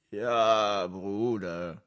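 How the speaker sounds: pitiful, drawn-out answer; armer Gefangener. A narrator's reading. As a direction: answer as the poor defeated captive. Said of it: "entgegnete der arme, gefangene Löwe". (kläglich gedehnte Antwort)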